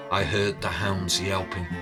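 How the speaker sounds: ordinary delivery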